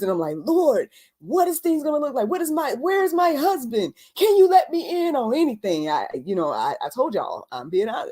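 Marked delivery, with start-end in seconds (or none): 0.4-5.9 high-pitched, bewildered pleading to God; confused plea. Retold or imitated. Recalling asking the Lord for answers, confused plea. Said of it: "I was curious and I was confused".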